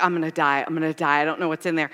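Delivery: low, soft, mock doomed mumble; playfully dreading the envelope. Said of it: "I would walk by 'em like".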